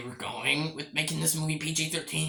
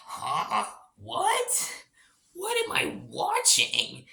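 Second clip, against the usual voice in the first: baffled disbelief; 6 semitones higher, much slower than usual. Retold or imitated. Reviewer recalls watching the opening, high baffled disbelief. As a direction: voice jumps high, slow and stunned. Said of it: "I'm like".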